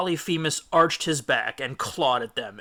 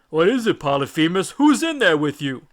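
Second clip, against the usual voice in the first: louder, higher giants calling; calling Cyclopses; 4 semitones higher, 8 dB louder than usual. A narrator's reading. Neighbouring Cyclopses calling to Polyphemus, worried. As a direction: call out to a neighbour in the night.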